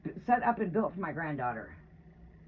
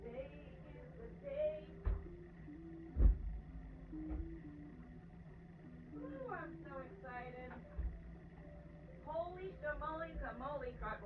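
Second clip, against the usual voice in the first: much higher, bubbling over with joy; giddy excitement; 5 semitones higher, 4 dB quieter than usual. Real, not acted. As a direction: squeal about the granddaughter coming. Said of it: "Oh, am I ever so excited".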